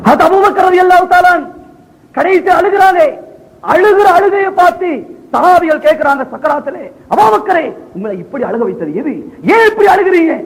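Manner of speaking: high, ringing sermon delivery; impassioned preaching. Acted. Preacher telling of Abu Bakr's deathbed tears, impassioned.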